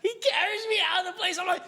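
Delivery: high, fast, laughing loudly. Real, not acted. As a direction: tell it cracking up. Laughing while telling.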